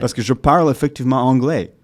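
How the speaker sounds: French in an English-accented voice. Shown as a demonstration. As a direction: speak French with English sounds. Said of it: "j'utilise les sons de l'anglais".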